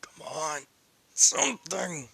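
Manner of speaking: strained, pleading inner thoughts; desperate inner voice. Acted. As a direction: beg for strength while barely able to breathe. Issei's struggling inner monologue.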